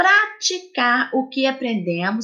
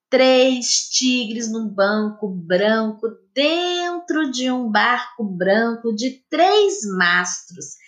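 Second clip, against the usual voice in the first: slow careful tongue twister reading. Shown as a demonstration. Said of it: "Leia com atenção a trava-língua".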